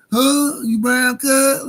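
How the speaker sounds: high, loud, drawn-out challenge; incredulous heckle. Acted. Host needling Ben Shapiro, incredulous. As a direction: stretch it out in loud mock disbelief.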